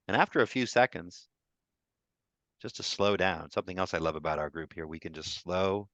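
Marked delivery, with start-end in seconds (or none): none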